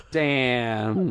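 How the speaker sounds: normal talking voice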